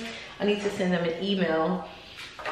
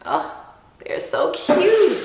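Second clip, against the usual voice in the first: gushing; 9 semitones higher, slower than usual. Real, not acted. Showing earrings to camera.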